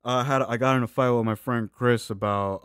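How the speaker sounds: ordinary delivery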